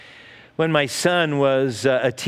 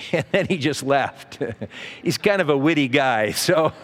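laughing through the words; amused chuckling. Real, not acted. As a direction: chuckle fondly while telling it. Chuckling at his son's witty comeback.

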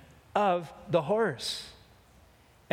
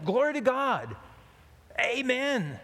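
higher and higher desperate cries; rising panic; 8 semitones higher than usual. Acted. Rider nearing the cliff cries out, higher panicked yells. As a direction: scramble for the word in panic. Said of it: "And so he yells out".

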